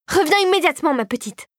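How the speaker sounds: calling her back. A narrator's reading. Old man calls Clara back.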